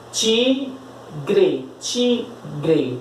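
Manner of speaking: TI... GRE, with a clear pause between the two syllables; sílaba por sílaba. Shown as a demonstration. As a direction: split "Tigre" slowly into TI and GRE. Separa a palavra em sílabas. ("Tigre" separado em sílabas, bem devagar)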